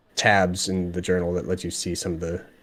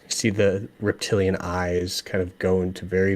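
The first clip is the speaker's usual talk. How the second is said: ordinary talk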